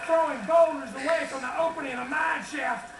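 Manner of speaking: folksy Texas twang; Texas accent. Acted. Folksy rancher telling family stories to a crowd, Texas accent.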